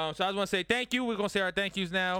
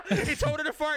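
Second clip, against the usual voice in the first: laughing; 13 semitones higher, 5 dB louder than usual. Real, not acted. Laughing retelling of a friend's comment.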